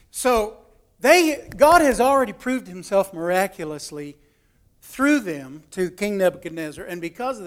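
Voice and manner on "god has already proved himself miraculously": ordinary delivery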